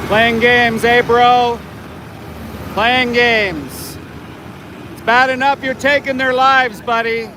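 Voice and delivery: heckling the driver from the roadside; taunting yell. Real, not acted. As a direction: needle him, voice up.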